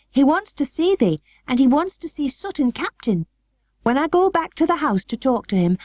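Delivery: put-on high girl proudly speaking Yorkshire; a learner showing off her Yorkshire; Yorkshire attempt. A narrator's reading. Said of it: "tried to twist her tongue into speaking Yorkshire".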